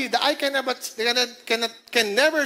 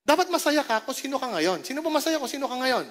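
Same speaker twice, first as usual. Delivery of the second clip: usual speaking voice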